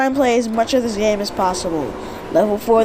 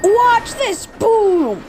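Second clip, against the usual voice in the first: excited show-off; 9 semitones higher, much slower than usual. Real, not acted. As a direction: hype up the stunt. Shows off a game move.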